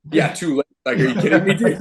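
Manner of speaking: high, loud mock disbelief; retold incredulity. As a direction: protest in amused disbelief, pitch climbing. Retold disbelief at being told to focus.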